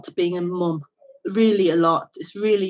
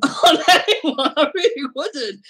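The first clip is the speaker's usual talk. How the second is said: laughing; 8 semitones higher, 4 dB louder than usual. Real, not acted. Laughs mid-sentence about motherhood.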